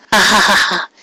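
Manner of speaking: mock villain laugh; evil laugh. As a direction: gloat with a wicked laugh.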